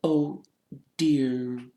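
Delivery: soft, high, halting sigh; sad Hermione. A narrator's reading. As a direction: say it gently and sorrowfully, with a pause. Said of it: "said Hermione sadly".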